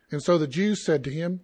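ordinary delivery

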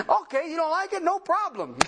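high, breezy mock agreement with a chuckle; mock-agreeable. God answering someone who dislikes his job, mock-agreeable. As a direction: cheerfully call the complainer's bluff. Acting God's breezy reply to complainer.

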